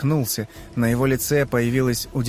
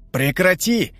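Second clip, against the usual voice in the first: shout; 6 semitones higher, slower than usual. Acted. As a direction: yell it to stop a fight. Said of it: "крикнул Феликс".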